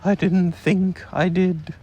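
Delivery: slow, worried little voice; unsure, fretting over his idea; a touch of worry, unhurried; anxious. Acted. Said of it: "said Pooh anxiously".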